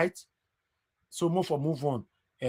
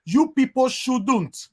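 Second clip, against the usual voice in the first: retold quote; 7 semitones higher, 4 dB louder, much slower than usual. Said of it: "She was only saying".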